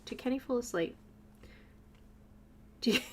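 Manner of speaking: ordinary talk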